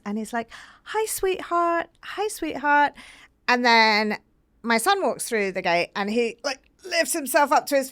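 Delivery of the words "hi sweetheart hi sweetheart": put-on high, slow, sweet coo to little girls. Dad volunteer greeting little girls at the gate, sweetly cooing. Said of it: "he has this really sweet little voice".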